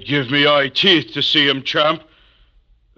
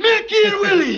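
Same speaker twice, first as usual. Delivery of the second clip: joyful shout; 4 dB louder than usual. Acted. Joyful greeting at door.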